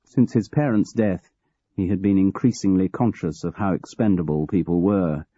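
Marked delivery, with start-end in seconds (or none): none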